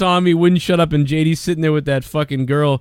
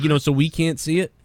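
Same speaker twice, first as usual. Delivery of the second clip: ordinary delivery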